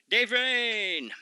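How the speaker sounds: Dave Rain called out high like a ring announcer; hyped name call. Shown as a demonstration. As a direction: belt out Dave Rain with a hype rise.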